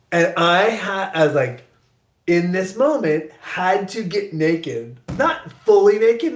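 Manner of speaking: normal talking voice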